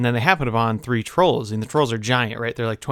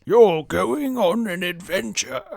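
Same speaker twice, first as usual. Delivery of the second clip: slow, higher wizard proclamation; Gandalf; 7 semitones higher, slower than usual. Retold or imitated. Wizard announces the quest to Bilbo, put-on drawn-out voice. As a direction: draw it out like a grand wizard. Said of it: "Gandalf shows up and he's like".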